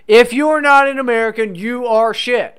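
loud, high-pitched mock tirade; mock patriotic shout. Comedian dismissing foreign accents to co-host, mock loud patriotic outburst. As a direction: belt it out as a mock proclamation.

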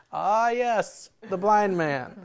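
high, grand Pharisee announcement; pompous Pharisee. Retold or imitated. Preacher mimicking the Pharisee line the kid heard, grandly. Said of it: "And it was just".

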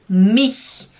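"mich" loud, with a raspy ch; ch na garganta. Shown as a demonstration. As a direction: push the ch of "mich" from the throat. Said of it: "Fala mich". ("mich" alto, ch arranhado)

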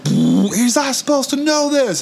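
very high, slow warning; whisper-warn like a worried ghost; eerie spirit voice. Retold or imitated. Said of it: "it's like".

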